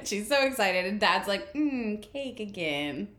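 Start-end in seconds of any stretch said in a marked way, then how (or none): 1.5-3.1 bored dad. Retold or imitated. Dad bored of daily cake.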